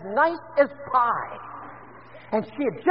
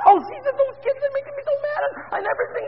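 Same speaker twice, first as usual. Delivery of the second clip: much higher, whiny complaining voice; mimicking upset mother. Pastor imitates Trudy complaining her kids make her mad, high whiny mimicry. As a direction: play the frazzled mom griping about her kids.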